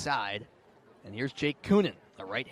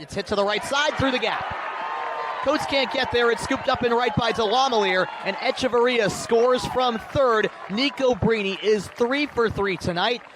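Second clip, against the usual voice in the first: loud, high, rising play-by-play shout; excited home run call; 5 semitones higher, 9 dB louder than usual. Real, not acted. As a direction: call the hit like the crowd is on its feet. Big hit, runner scores on play-by-play.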